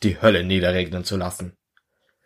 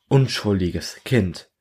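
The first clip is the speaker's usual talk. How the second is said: usual speaking voice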